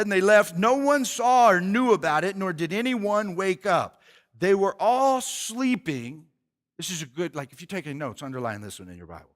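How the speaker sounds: ordinary delivery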